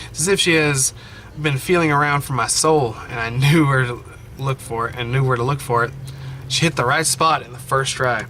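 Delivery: normal talking voice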